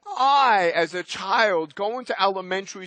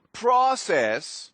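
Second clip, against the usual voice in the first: normal talking voice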